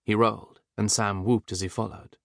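ordinary delivery

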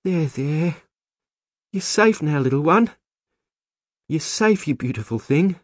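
high, tender cooing; cuddling a baby. A narrator's reading. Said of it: "turning to the child as she cuddled it".

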